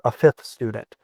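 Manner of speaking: normal talking voice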